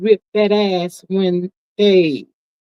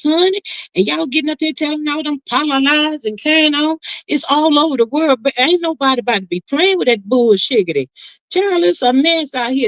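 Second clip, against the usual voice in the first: indignant high rant; 6 semitones higher than usual. Real, not acted. Indignant rant about donation money.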